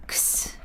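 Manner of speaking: 크스 as two consonant sounds, k then s; teacher sounding out the "ks" in fix as 크스. Shown as a demonstration. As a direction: isolate the X sound as 크스. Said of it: "X는 어떤 소리가 나요".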